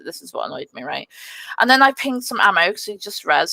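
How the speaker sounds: normal talking voice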